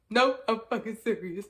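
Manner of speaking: loud, emphatic insistence; insisting loudly. Retold or imitated. Insisting loudly to a friend who won't believe her. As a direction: push it out loud so she believes.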